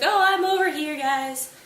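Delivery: high, loud answering call; calling back. Retold or imitated. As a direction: holler back to friends some way off. Prince calls back to searching friends.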